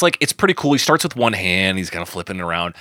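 normal talking voice

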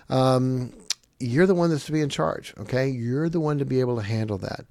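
usual speaking voice

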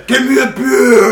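loud high roaring shout; Chewbacca roar voice. Pretend. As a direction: howl it like a Wookiee. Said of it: "almost Chewbacca now".

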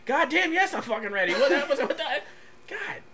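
loud, high-pitched exasperation breaking into laughter; pissed-off outburst. Real, not acted. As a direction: yell impatiently, half laughing. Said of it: "you getting pissed off".

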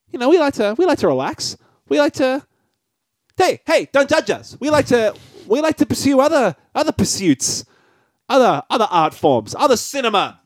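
hammy high-pitched posh intro voice; pompous high voice. Acted. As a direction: play a self-important connoisseur, pitched high. Pompous mock-refined segment intro.

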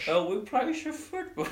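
put-on English coach faking a Dutch accent; Steve McLaren fake Dutch accent. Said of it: "Steve McLaren".

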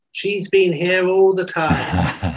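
slow, high Italian policeman's reveal. Acted. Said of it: "And the Italian says".

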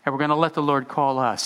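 ordinary delivery

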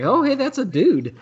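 high, lilting mock surprise; re-enacted high-pitched double take; surprised realization. Acting out his moment of realization.

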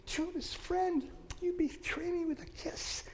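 high, soft, wounded plea; pleading heartbreak. Acted. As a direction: beg a betraying friend. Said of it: "he's holding on to him".

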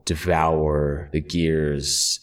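low, slow, droning otherworldly monotone. Acted. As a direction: intone it low and slow like a possessed thing. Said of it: "in a low, monotonous tone, that doesn't fit [name]'s, normal voice".

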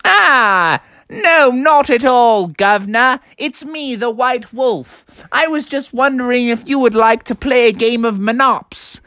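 high, drawling jovial voice. Acted. Wolf invites frogs to a game, jovial. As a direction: reassure them in a jaunty character voice. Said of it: "And he's like".

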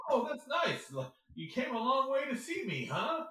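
high, sugary patronizing voice; mock-cheery condescension; imitating actor. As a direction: talk down sweetly like to a child. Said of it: "He just".

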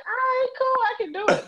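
high, breezy imitating voice; breezy younger self. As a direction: lightly voice her carefree past. Quoting her younger self.

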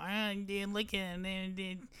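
high, hushed mock bro voice; breathy high parody of a bro; mocking dude voice. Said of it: "I can't stand when people do that".

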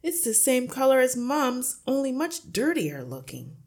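high, loud, cheeky son; taunting accusation. A narrator's reading. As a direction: point it out loudly to your dad. Son accusing father, louder and higher.

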